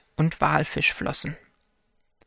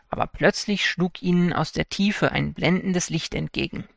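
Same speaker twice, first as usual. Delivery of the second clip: ordinary delivery, nothing marked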